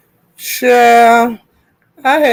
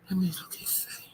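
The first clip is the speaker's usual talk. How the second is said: soft whisper while looking; whispering to herself; 3 semitones lower, 26 dB quieter than usual. Real, not acted. Streamer whispering to herself while searching the app. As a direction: breathe the words to yourself.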